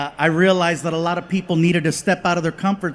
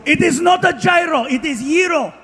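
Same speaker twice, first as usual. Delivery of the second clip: put-on higher, annoyed Greek accent; pissy Greek vendor; 7 semitones higher than usual. Greek vendor correcting the pronunciation, pissy Greek accent. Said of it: "He got all pissy".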